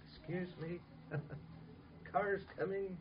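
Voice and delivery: soft, mild polite excuse me; polite murmur. Shown as a demonstration. To a child running into the street, a soft polite murmur. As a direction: murmur it far too politely. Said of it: "you don't say".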